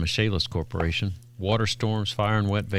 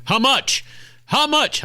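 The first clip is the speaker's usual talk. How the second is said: pushy client; 4 dB louder, slower than usual. Retold or imitated. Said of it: "I told Lance".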